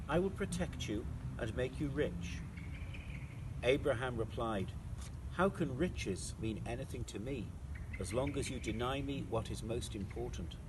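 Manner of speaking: ordinary talk, nothing marked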